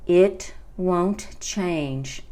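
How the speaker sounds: "It won't change from day" at half speed; slow model phrase. Shown as a demonstration. Teacher repeating the song's line for learners, very slowly. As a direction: space out each word of "It won't change from day".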